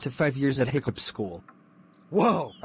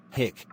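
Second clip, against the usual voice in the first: hiccup; 2 semitones lower, 4 dB quieter than usual. Acted. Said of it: "I think you have the hiccups".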